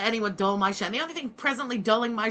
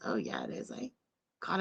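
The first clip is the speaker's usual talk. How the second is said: ordinary delivery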